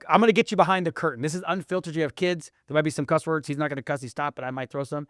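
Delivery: ordinary delivery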